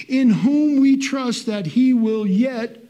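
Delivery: slow climactic pulpit proclamation; full-force preaching. Acted. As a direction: swell the scripture line like a preacher.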